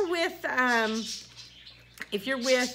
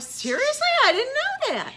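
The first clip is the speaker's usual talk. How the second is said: high, astonished disbelief; pitched-up "seriously?" in wonder; amazed; 12 semitones higher, 4 dB louder than usual. Retold or imitated. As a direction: sound floored by the news. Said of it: "I'm like".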